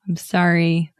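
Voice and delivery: ordinary talk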